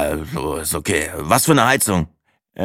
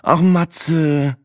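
high, drawn-out sighing address; 10 semitones higher than usual. A narrator's reading. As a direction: sigh the name in a higher woman's voice. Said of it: "seufzte Sina". (seufzend, mit hoher Stimme)

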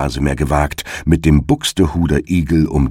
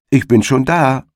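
high, carrying hedgehog hail; lightly acted storybook call; 8 semitones higher than usual. A narrator's reading. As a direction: call it in a raised pitch. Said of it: "rief ihm die Frau des Igels entgegen".

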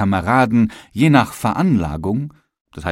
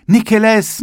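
calling out; 13 semitones higher, 6 dB louder than usual. Acted.

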